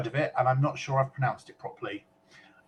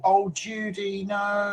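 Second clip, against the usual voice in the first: higher, drawn-out sympathetic groan; sympathetic dismay; 8 semitones higher, slower than usual. Real, not acted. Host hearing a listener had a fall, sympathetic dismay. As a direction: stretch it out slowly in concern. Reacting to news of Judy's fall.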